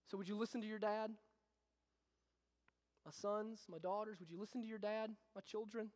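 put-on a dad softly asking his children for one thing; soft plea. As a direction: ask gently, almost a hush. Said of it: "and he says".

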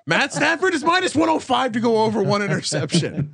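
high-pitched, laughing disbelief; incredulous outburst. Real, not acted. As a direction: exclaim the odds in amused outrage. Laughing disbelief at betting odds.

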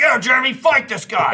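high, loud mock dad egging him on; a father shouting to his son to fight. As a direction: yell it like a rowdy dad at a brawl. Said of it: "And he'd be like".

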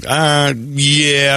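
drawn-out hesitant yeah; reluctant admission. Retold or imitated. Reluctant answer to friend's question.

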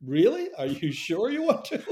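high-pitched incredulous check; doubtful questioning. Retold or imitated. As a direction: ask it sceptically, pitch lifted high. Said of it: "And I went".

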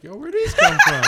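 high, loud laughing quote. Retold or imitated. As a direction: laugh through the question. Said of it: "I was like".

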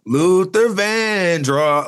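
very slow, leaning hard on "Van" in Vandross; imitating the [name] line. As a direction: stretch out [name] and hit "Van". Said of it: "he hits the Van part".

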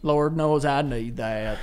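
slow, drawn-out hillbilly voice; hillbilly accent. Retold or imitated. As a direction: echo it back in a hillbilly accent. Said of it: "Why'd you say that like a hillbilly".

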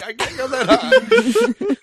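high, loud squeak through laughter; straining too high. Real, not acted. Sick host failing to hit a high note, squeaky and laughing. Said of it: "currently my voice is going".

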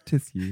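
"tissue" as tiss-yoo, low and soft; copied posh tissue. Retold or imitated. Friend echoing the mocked word, posh tiss-yoo. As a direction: echo "tissue" with s-y, no sh.

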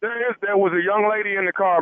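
usual speaking voice

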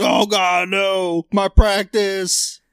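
high, drawn-out Southern wail; Southern despair, hammed-up; mock Southern cry of loss; Southern accent. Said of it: "giving a Southern accent".

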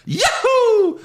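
loud falsetto whoop; Mario. Acted. Host finishing the Mario bit, very high loud whoop. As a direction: squeal out a long video-game cheer. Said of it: "everyone knows Mario with that voice".

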